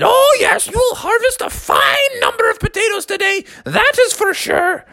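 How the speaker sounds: very high squealing auntie voice; squealing baby talk aunt. A narrator's reading. Aunt Molly voiced again.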